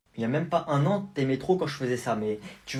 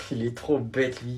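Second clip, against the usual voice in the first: normal talking voice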